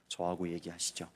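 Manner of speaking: humbly smooth it over with the client; gently deferential sorry; polite apology. Retold or imitated. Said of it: "정중하게".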